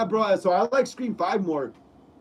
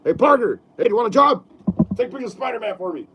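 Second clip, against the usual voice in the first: louder editor calling Parker, then rushed; brisk gruff boss giving orders; quick Jameson bark, lightly done; J. Jonah Jameson impression; 2 semitones higher, 4 dB louder, much faster than usual. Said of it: "impersonating, uh, J K Simmons".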